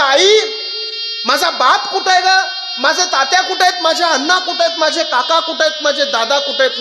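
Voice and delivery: a bride calling out for her father, close to tears; tearful daughter's plea. Acted. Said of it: "तुमच्या आईला तुम्ही म्हणता". (बापाला शोधणारी रडवेली मुलगी, उंच आवाजात)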